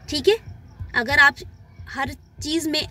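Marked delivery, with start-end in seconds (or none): none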